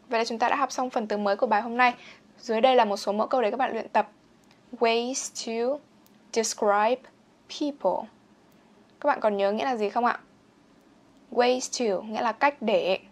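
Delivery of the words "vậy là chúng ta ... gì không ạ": normal talking voice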